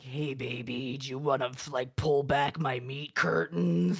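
low, slow come-on; mock seduction. Trolling her husband, mock seduction. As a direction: draw it out low like a hammed-up seductress. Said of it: "I'll be like".

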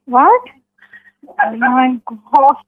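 ordinary talk, nothing marked

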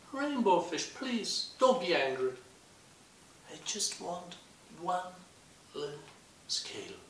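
high, small pleading voice; timid pleading. Acted. Little blue fish begging the rainbow fish, high and timid. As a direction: ask shyly for just one scale.

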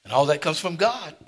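loud, high preaching burst; preaching at full force. Real, not acted. Pastor stirring his church, loud preaching. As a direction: lift the congregation with fervour.

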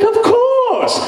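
high, posh British agreement; a grand British of course; high British luvvie voice; British accent. Retold or imitated. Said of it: "without the British accents".

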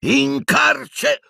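high-pitched battle yell; roared spell. A narrator's reading. Harry casting at Piton, roaring. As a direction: roar the incantation out high. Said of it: "ruggì Harry".

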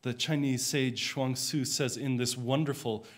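ordinary talk, nothing marked

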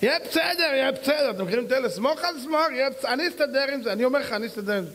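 high, breezy mock it'll-be-fine voice; a careless guy talking himself into it, pitched high; mock self-assurance. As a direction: ham up someone brushing off worry. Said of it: "אתה משכנע את עצמך".

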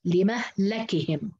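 slow لمهلكهم as "li-mah-la-kihim"; double fatha reading. Shown as a demonstration. Teacher repeating Shu'ba's reading, slow and measured. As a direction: sound out لمهلكهم slowly, open a on the second ل. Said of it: "قرأ بفتح الميم واللام الثانية".

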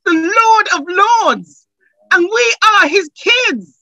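high, loud, rousing preaching that keeps climbing; full-force preaching. Acted. Pastor lifting the congregation, full-force rousing preaching.

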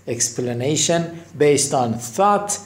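"Explanation based on thought" stretched out slowly; slow demo. Shown as a demonstration. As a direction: read it slowly word by word. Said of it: "slow teaching demo". (آهسته و شمرده)

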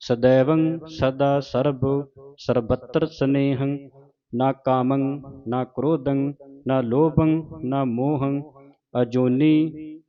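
slow, measured chanting of gurbani; recitation. Shown as a demonstration. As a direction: recite each line as a chant.

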